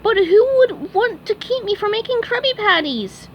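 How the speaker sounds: very high, eager cartoon sponge voice; high squeaky sponge voice; SpongeBob voice. A narrator's reading. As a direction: chirp it like a keen little fry cook. SpongeBob speaks.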